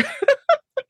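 high, short giggle; amused laugh. Real, not acted. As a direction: laugh at your own younger self. Laughs at own immature younger self.

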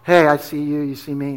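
low, hushed, confidential greeting; playing it cool. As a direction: keep it down, like a discreet nod. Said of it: "I knew how to be cool".

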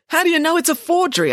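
high, loud startled question; incredulous challenge. A narrator's reading. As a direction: demand proof in disbelief. Headmaster challenges the girl's claim.

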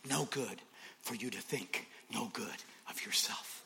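quiet. Shown as a demonstration. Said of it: "that's tweetable".

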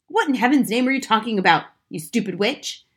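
loud, quick, exasperated outburst; angry shout. A narrator's reading. Husband to lecturing wife, shouting angrily. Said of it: "Mister Wormwood shouted".

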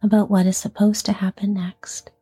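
unhurried yoga nidra voice, letting each phrase settle; guided sleep meditation. Acted. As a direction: ease a listener toward sleep, phrase by phrase. Leads guided sleep relaxation.

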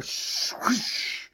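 unvoiced swishing fall noise; whooshing crash. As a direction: imitate a long rush of falling. Imitates the falling beanstalk.

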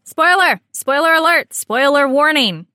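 mock warning shouts; calling out a warning. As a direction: sound the spoiler alarm. Announcing spoilers, calling out.

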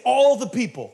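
"All" stretched high and loud; mock exaggeration. Preacher mimicking the spies' report, exaggerated stress on "All".

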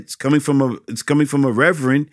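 usual speaking voice

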